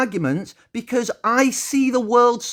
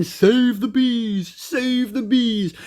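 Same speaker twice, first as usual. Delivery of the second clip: slow, drawn-out put-on chant; mocking, slow advert voice; mock advert slogan; 3 semitones higher, much slower than usual. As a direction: imitate a campaign slogan, stretched out slowly. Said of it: "all these adverts literally everywhere saying".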